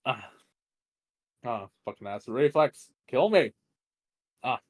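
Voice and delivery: loud cry of real pain; pained outburst. Real, not acted. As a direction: yelp through sudden pain. Reader hurts herself, reflex.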